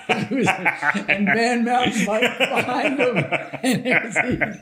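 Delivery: drawn out slowly through a laugh; savoring the joke as laughter breaks in. Real, not acted. Host joking about the vaccine line, laughing slowly.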